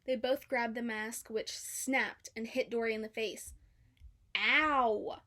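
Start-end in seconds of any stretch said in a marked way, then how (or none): 4.4-5.2 yelp of pain. A narrator's reading. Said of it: "Dory cried".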